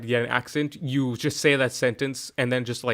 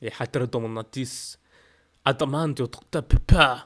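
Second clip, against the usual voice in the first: amateur put-on French; French accent. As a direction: ham it up in shaky schoolroom French. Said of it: "my French isn't that good".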